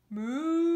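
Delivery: a loud mock moo; cow moo. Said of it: "why don't you try my moo?".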